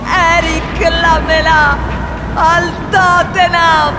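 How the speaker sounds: slow, high, shouted damnation; furious curse. Acted. Cursing Romans for selling Lamela, slow furious shout. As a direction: curse them slowly at the top of your voice.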